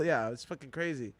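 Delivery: usual speaking voice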